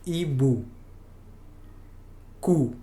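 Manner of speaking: "hibou, cou." with a clean French ou; French ou sound. Shown as a demonstration. Teacher modeling ou words for learners, careful French. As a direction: model the ou at the word ends. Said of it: "Por ejemplo".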